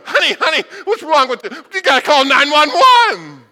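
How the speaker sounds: high, loud mock panic, slowing at the end; shrill alarm, hammed-up; panicked neighbor. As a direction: neighbor panicking at the table saw. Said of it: "What do you think the neighbor going".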